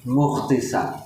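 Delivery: مختی with KH in place of F. Retold or imitated. Teacher imitating those who say مختی with KH. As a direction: address the mufti as مختی, with throaty KH. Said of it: "مفتی کو مختی بولتے ہیں".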